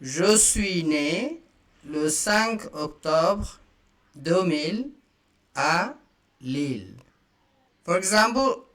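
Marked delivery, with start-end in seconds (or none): 0.0-7.0 each chunk slowly set apart with pauses; slow, chunked repeat. Shown as a demonstration. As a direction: break it into pieces for learners to copy. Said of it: "Once again".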